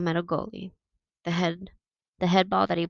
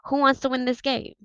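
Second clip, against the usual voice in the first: rallying call; 7 semitones higher, 4 dB louder than usual. Acted. Captain rallies the team.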